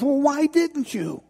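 high, drawn-out plea; distraught man demanding a reason; anguished protest. Acted. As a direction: wail it as a wounded question.

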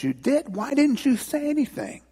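high, incredulous mock protest; astonished boy. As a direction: squeak it out in acted disbelief. Acted boy astonished at grandmother's confession.

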